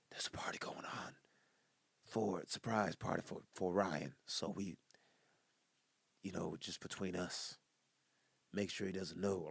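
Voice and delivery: soft, hushed surprise-party secret; secret whisper. Shown as a demonstration. Telling a friend about Ryan's surprise party, soft whisper. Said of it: "that's the only time you can whisper".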